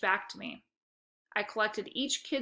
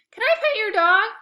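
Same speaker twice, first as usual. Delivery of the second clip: put-on high, loud little kid voice; a small boy begging to pet; eager young boy; 13 semitones higher, 11 dB louder than usual. A narrator's reading. Second grader asking to pet the dog, high and eager. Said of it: "asked a boy running up".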